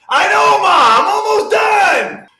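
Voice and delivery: high, loud, drawn-out yell; shouting. Acted. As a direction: holler back at mom. Yelling back to mom.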